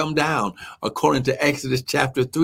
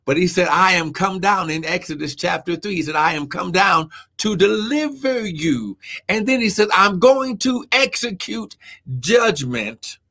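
raised pitch, emphatic sermon; impassioned preaching; 4 semitones higher than usual. Real, not acted. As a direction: preach it hard, leaning on the key words. Preaches God's judgment with raised pitch.

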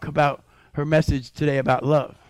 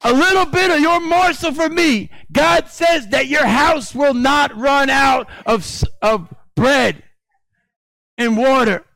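raised, fervent preaching cadence; 6 semitones higher, 6 dB louder than usual. Acted. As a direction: preach it out to the congregation. Pastor preaching at full force.

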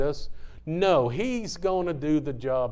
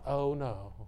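soft, timid little protest, imitated; mock a meek man, much softer; hushed mock-meek voice; mock meekness; 9 dB quieter than usual. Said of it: "He wasn't like".

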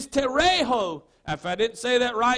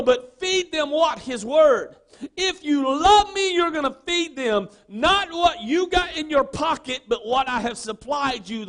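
ordinary talk

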